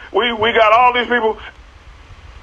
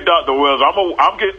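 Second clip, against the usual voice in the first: ordinary talk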